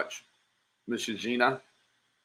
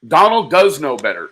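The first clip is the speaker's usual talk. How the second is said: loud, sharp comeback shutting her down; angry retort; 9 dB louder than usual. Real, not acted. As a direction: bark it back at her, louder. Host angrily rebuts caller.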